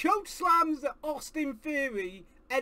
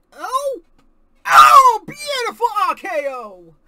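loud cry of delight; excited shout; 6 semitones higher, 16 dB louder, slower than usual. Real, not acted. Fan roaring at a finishing move, delighted shout. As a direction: roar at the big move.

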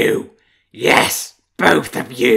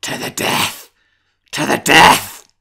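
snarling, loud war cry of a wolf; wolf's battle cry; 3 semitones higher, 12 dB louder, slower than usual. Acted. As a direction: roar it out like a charging beast.

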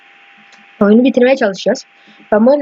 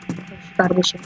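muttered; 3 semitones lower, 10 dB quieter, slower than usual. Real, not acted. Urging character to run.

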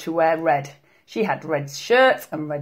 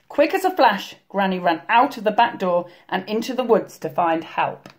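ordinary delivery, nothing marked

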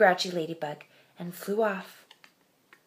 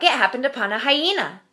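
usual speaking voice

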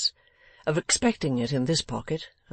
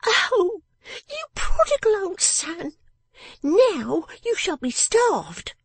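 very high, drawn-out scolding; scolding person of the house; 5 dB louder, much slower than usual. Acted. Miss Wren threatens her prodigal father, scolding and high. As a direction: berate him slowly in a high, loud voice.

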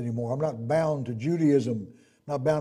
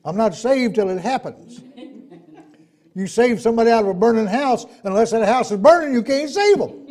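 raised, loud pulpit voice; forceful preaching; 8 semitones higher, 8 dB louder than usual. Real, not acted. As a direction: press the point hard on the congregation.

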